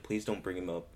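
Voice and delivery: low, soft retold plea; upset plea. Retelling sister's reaction to [name]'s death, subdued plea. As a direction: quietly imitate someone too upset to discuss it. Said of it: "was so torn up about it".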